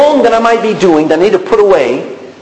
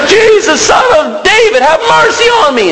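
very high, desperate shouted plea; beggar's all-out high cry; crying out louder. Acted. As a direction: shout over the crowd for mercy. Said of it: "begins to cry out even louder".

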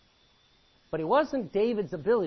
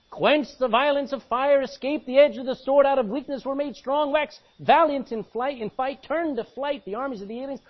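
high, loud pulpit declamation; ringing, forceful Bible reading; rousing scripture reading; 7 semitones higher, 6 dB louder than usual. Real, not acted. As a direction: build the list like a triumph. Reads scripture at full force.

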